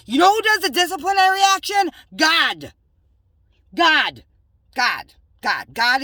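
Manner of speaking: high, loud question, then God repeated and fading; emphatic repetition. Acted. Answering her own question for viewers, emphatic then fading. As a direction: hammer the name home, then let it sink.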